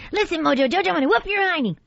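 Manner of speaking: very high, tough little cartoon girl; squeaky little fighter making a threat. Shown as a demonstration. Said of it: "okay, this one is".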